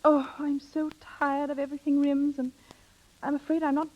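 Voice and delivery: a wistful, weary plea, pitched a little up; coached sad plea. Acted. Secretary coaching a friend to win Rims, acting the plea. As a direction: sigh it out as if tired of everything. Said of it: "you'll say these words".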